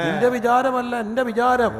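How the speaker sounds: loud, measured declamation of scripture; forceful pulpit declaration; emphatic preaching. Acted. Preacher declaims God's words.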